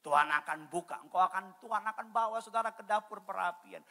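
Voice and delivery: ordinary delivery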